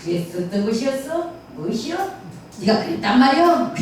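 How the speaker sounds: 구수한 전라도 판소리 말투; Jeolla pansori dialect. Shown as a demonstration. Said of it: "전라도 소리여가지고 좀 구수한 맛".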